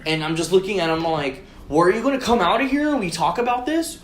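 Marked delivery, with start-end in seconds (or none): 1.7-4.0 higher, rising exasperated question; impatient demand. Retold or imitated. Driver confronts man in dumpster, impatient retold question. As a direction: press him with a rising pitch.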